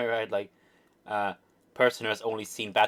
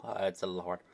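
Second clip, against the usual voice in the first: ordinary delivery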